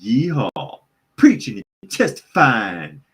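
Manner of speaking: exaggerated mock Southern exclamations; mock Southern preacher. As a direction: parody a fake Southern accent, drawn out. Mocking candidate's put-on Southern speech.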